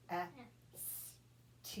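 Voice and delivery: P, E, S, T said one at a time; letters pulled apart one by one; sounded out letter by letter. Shown as a demonstration. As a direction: tap out each sound with pauses. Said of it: "go ahead and tap it".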